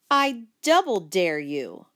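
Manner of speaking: deliberate defiant dare, leaning on "double"; confrontational dare. Acted. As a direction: raise the stakes, nose to nose. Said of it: "I added as I drew up nose to nose".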